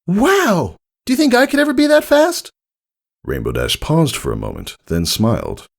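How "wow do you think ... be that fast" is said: very high, fast, amazed filly; awestruck Scootaloo. A narrator's reading. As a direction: starstruck fan. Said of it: "eyes bright with awe".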